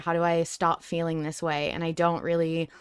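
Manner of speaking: ordinary delivery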